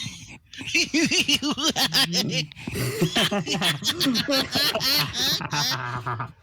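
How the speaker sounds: long, high laughing fit; acted troll laughing fit. Troll mocking John in chat, high drawn-out laughing. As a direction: keep a taunting troll laugh rolling. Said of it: "just laughing and stuff".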